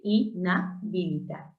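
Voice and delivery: "Inhabilitar" softly as i, na, then bilitar; correct syllable split. Shown as a demonstration. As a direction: separate the opening syllables of "Inhabilitar". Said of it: "Eso es lo correcto".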